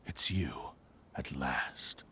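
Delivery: low, soft hushed whisper; menacing whisper. Acted. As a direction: breathe the words as a menacing hush. Recognizing an enemy in whisper.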